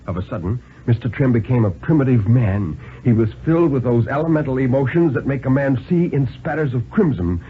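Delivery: ordinary delivery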